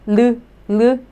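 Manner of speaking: 르, 르 tapped with the tongue touching the palate; Korean 르 twice. Shown as a demonstration. Teacher drilling the tongue position, tapped 르. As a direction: repeat the tap twice for the class. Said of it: "Your tongue must touch your palate".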